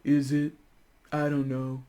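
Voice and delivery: mopey Eeyore. Pretend. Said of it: "Eeyore reply".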